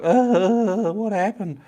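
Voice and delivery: high-pitched, stunned little mosquito voice; dazed mosquito. Acted. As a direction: groan it like a bug that just hit a wall. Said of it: "like a mosquito that hit the wall".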